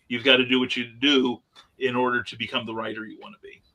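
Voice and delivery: ordinary talk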